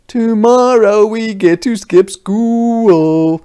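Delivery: put-on high, loud little-boy shout; excited little boy yell. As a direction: yell it like a thrilled kid brother. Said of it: "yelled Ziad".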